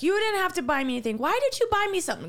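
fast, high imitation of a fussing mom. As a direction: rattle it off like mom protesting a gift. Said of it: "Going on and on about".

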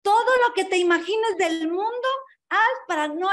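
high, loud insistence on every word; loud, firm rabbinic pronouncement; emphatic command. Retold or imitated. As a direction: drive the answer home like a rabbi. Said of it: "Le dijo".

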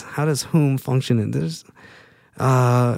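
ordinary delivery

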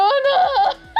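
high, loud "no" breaking into laughter; hammed-up overwhelmed squeal; mock wail. Fan offered five minutes with idols, mock wail.

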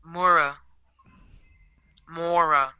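Mora said as MOR-uh. Shown as a demonstration. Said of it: "There's so many different ways".

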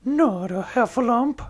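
a startled cry of dread; alarmed exclaim. Acted. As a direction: Pooh hearing the bad news, alarmed. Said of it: "I exclaimed".

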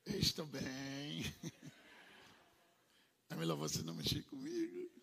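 slow, quietly voiced inner voice of a woman; lightly acted, slow and guarded; a hint of a defensive woman, slow; voicing her inner thoughts. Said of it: "o que é que ela tá dizendo".

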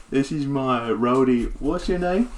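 swagger it like a washed-up rock star; laid-back bard introducing himself, cocky. Acted. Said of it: "he's like".

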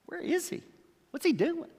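high, hushed anxious asking; anxious searching. Acted. Preacher voicing Jairus searching the crowd, high and anxious. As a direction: voice a worried father scanning the crowd.

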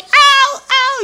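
high, loud mock cries of pain; playful imitation of boys yelping "au"; mock boys' yelps. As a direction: imitate kicked boys howling. Boys writhing after being kicked.

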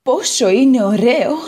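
an eager little potato telling her woes; Potato character voice. Acted. Potato moved.

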